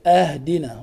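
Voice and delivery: "أهدنا" starting with a, ahdina; fatha on alif. Shown as a demonstration. Teacher repeats the mistake, initial a in "أهدنا". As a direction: begin "أهدنا" with an open a. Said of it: "بفتح الألف".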